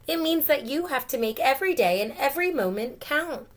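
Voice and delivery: earnest mom explaining; mom's voice. Acted.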